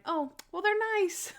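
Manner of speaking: chirpy helpful woman who suspects nothing; high naive helper voice; innocent Natalie. Retold or imitated. Natalie compliments the bolt cutters.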